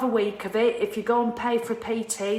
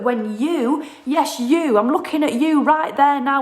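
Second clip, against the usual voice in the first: loud, raised pitch, pointed at the listener; single them out loudly and insistently; emphatic rant; 4 semitones higher, 7 dB louder, slower than usual. Real, not acted.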